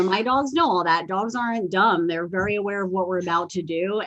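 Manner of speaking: ordinary talk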